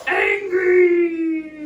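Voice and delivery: very loud, drawn-out growly cry; acted anger. Shown as a demonstration. Teller shows child how queen feels, shouting angrily. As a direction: act out the word with full force.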